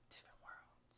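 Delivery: breathy unvoiced whisper of different worlds. Shown as a demonstration. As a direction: whisper it, no voice at all. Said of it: "I have to whisper louder".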